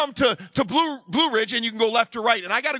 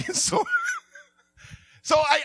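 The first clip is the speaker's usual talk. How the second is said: ordinary talk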